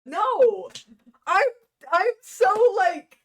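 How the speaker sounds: loud, high outburst; upset at self. Real, not acted. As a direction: wail it out, frustrated with yourself. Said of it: "upset at myself".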